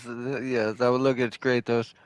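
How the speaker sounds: ordinary delivery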